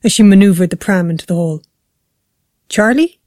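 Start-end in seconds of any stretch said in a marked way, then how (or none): 2.7-3.2 higher questioning call with a rise; raised, searching call. A narrator's reading. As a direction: call for your son, unsure he is there. Mother calling for her son.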